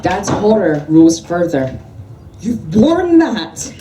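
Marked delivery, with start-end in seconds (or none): 2.4-3.7 high, slow, loud horrified screech. A narrator's reading. Dan recoiling from the merkin, screeching slowly up high. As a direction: stretch it out in a high shriek of disgust. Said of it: "he screeched".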